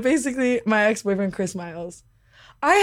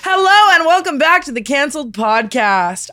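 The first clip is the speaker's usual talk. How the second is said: ordinary delivery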